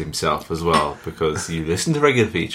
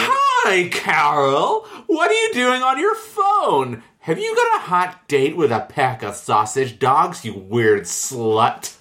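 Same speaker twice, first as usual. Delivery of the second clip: Pussy Pals voice; 10 semitones higher, slower than usual. Acted. Pussy Pal Debbie teasing Carol about her phone, hammed-up character voice. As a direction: squeal the greeting, then stretch out the final insult. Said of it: "start it in the Pussy Pal's voice".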